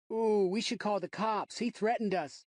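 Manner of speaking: high, slow sing-song taunt; mocking kid. A narrator's reading. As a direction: tease him like a brat. Kids mocking narrator.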